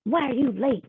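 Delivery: high, sharp-pitched angry question; cross demonstration of annoyance. Shown as a demonstration. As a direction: demand it crossly, voice jumping up. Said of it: "Or if I was angry".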